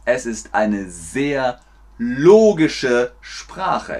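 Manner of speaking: slow, spaced words with stretched "logische"; slow teacher delivery. Shown as a demonstration. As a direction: spell out the claim slowly for learners. Langsam für Lernende vorgesprochen. (langsam und deutlich für Lernende)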